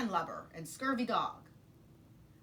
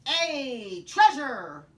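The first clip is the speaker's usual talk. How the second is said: very loud, high crew cheer; pirate crew shout; 5 semitones higher, 14 dB louder, much slower than usual. Acted. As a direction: roar it as a greedy crew. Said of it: "the others shouted".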